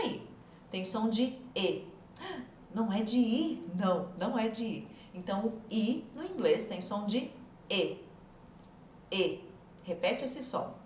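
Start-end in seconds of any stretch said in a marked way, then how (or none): 1.6-1.9 E said as closed ê, as with a circumflex; a held, closed "E" like Portuguese ê. Shown as a demonstration. Teacher models the English I sound, closed ê. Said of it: "E com acento circunflexo". 9.1-9.5 E as a closed, low-pitched ê. Shown as a demonstration. Teacher has students repeat the sound, low closed ê. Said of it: "aquele E com acento circunflexo".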